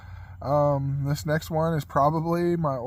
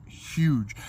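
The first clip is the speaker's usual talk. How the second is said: ordinary delivery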